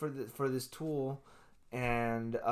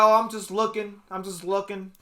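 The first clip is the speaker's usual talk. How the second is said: louder, higher imitation of an excuse; mock defensive protest; 6 semitones higher, 10 dB louder than usual. Man imitates neighbor excusing his peeking, mock defensive.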